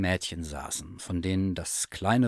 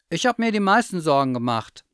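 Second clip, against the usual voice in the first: put-on high, loud child's claim; emotional insisting; 8 semitones higher, 7 dB louder than usual. A narrator's reading. Child insists he worried most.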